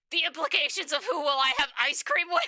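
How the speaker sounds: loud, high-pitched disbelief; high, loud and baffled; incredulous outburst. Real, not acted. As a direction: blurt it out loudly in real bafflement. Reacts in disbelief to the game.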